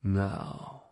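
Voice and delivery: hushed and calm; softly. A narrator's reading. Mentor calming an amazed pupil, softly. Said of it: "Jason said softly".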